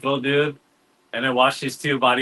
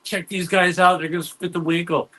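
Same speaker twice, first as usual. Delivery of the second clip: higher-pitched retold call to look; excited friend's heads-up; 6 semitones higher than usual. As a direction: imitate a buddy urging everyone to watch. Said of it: "he's like".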